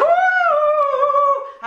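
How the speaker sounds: very high, long held "ooh"; mock howling. Imagining everyone howling on balconies at dawn, mock howling. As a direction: imitate someone wailing from a balcony. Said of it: "à hurler".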